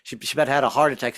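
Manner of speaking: usual speaking voice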